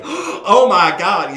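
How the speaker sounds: loud, long, stretched cry of shock; belted out. Retold or imitated. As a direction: belt it out in disbelief. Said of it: "just belted out".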